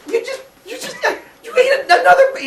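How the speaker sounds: mother losing it again; shocked shriek. Acted. Rhea shrieking at Cronus after the second baby.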